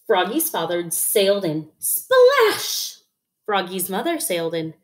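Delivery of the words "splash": high-pitched drawn-out "splash"; splash sound effect. A narrator's reading. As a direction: voice the splash with a long sh. Story sound word, drawn out.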